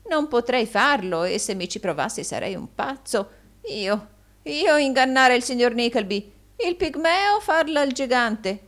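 high, loud protesting voice; hammed-up humility with tittering; wheedling old usurer. Gride protests fawningly.

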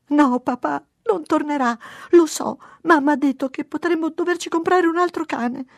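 high, loud wailing protest; acted little girl's distraught whine; tearful child. A narrator's reading. Daughter telling papà the dog won't return, tearful. Crying child answers her father.

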